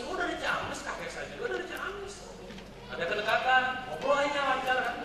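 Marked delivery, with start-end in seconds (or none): 0.1-2.1 high, soft mock surprise at shared roots; delighted recognition. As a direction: act pleasantly surprised, high and hushed. Acted rapport-building small talk.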